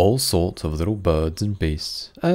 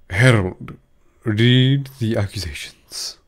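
loud, slow royal order; a king commanding his herald; king's command; 2 semitones higher, 5 dB louder, slower than usual. A narrator's reading. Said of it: "said the king".

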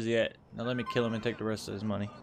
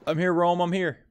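raised, higher call to a cousin; 7 semitones higher, 7 dB louder than usual. Pretend. Gamer to cousin Roman in trouble, calling out loudly. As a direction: call out loudly that help has arrived.

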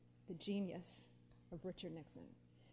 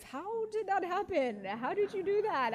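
a higher, louder, slow, lightly voiced Nixon asking in disbelief; amused Nixon; 10 semitones higher, 7 dB louder than usual. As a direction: play a lightly amused Nixon, teasing but curious. Nixon teasing her as a conservative.

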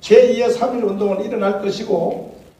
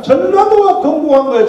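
higher and louder, 전라도가 hammered hard; exclaimed claim; 6 semitones higher, 7 dB louder than usual. Real, not acted. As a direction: proclaim it proudly to the crowd. Preacher exclaims to crowd.

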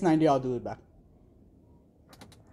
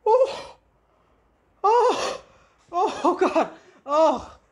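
startled shrieks; 8 semitones higher, much slower than usual. Real, not acted. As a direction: yelp in sudden fright. Jumpscares in horror game.

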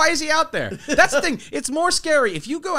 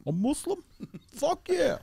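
high, soft, goofy delight; playful celebrity impression; Tracy Morgan; 7 semitones higher, 6 dB quieter than usual. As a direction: oddball comic lilting way up.

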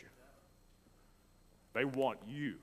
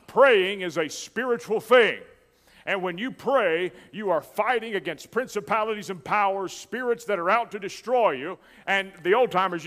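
raised, driving pulpit delivery; full-force preaching; 6 semitones higher, 9 dB louder than usual. Real, not acted. Preacher telling the church that prayer is a battle, full-force preaching. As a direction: preach it hard to the congregation.

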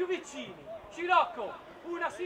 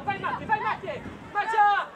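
higher, loud sideline cheering; excited shout; 5 semitones higher, 5 dB louder than usual. Real, not acted. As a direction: drive the runner on with your voice. Coach cheering a run.

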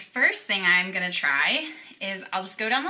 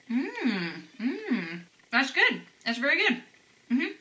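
talking between bites of food; chewing with mouth full; 4 semitones higher, slower than usual. Real, not acted. Seen 0: bites cookie at -2, chews with closed mouth through words.

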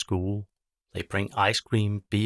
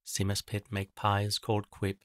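ordinary delivery

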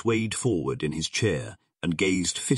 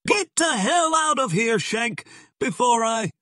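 very high, loud angry yell; furious shout; 5 dB louder than usual. A narrator's reading. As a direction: explode at an unwelcome visitor, cut off mid-threat. Furious order to get out.

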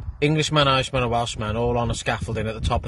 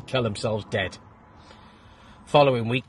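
ordinary talk

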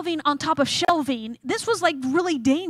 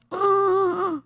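high, airless choked reply; strained, drawn-out choking whine; choking noise; 6 semitones higher than usual. Retold or imitated. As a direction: imitate answering with a blocked throat. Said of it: "Are you choking?".

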